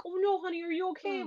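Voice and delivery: high-pitched cooing concern, acted; imitating worried parent. Parent to a child who fell, mock-fussing.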